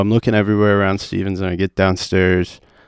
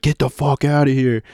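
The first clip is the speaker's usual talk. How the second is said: higher-pitched dismissal; mildly heated retold command; retold angry order; 5 semitones higher than usual. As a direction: imitate someone shoving you out. Retold guys pushing him out.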